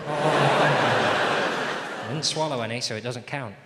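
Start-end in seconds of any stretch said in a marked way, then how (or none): 0.1-2.0 loud laughing groan. Real, not acted. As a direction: burst out laughing. Laughing exclamation.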